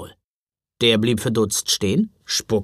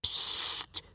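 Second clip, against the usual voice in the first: hushing psst; 3 dB quieter than usual. Acted. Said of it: "machte der Lebkuchenmann".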